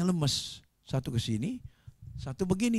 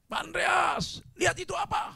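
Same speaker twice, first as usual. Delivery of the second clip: high, alarmed yell; acted captain yelling in alarm; captain's shout; 4 dB louder than usual. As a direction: shout to a crewman to look. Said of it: "Kapten kapal teriak".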